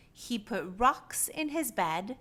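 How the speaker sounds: ordinary delivery, nothing marked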